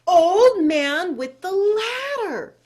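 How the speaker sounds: high, loud, stretched-out holler; desperate shout. Acted. Chang to the unanswering old man, desperate shout. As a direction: holler with all your remaining breath. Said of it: "with his very last bit of breath, he shouted".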